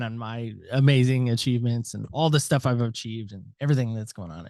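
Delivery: ordinary delivery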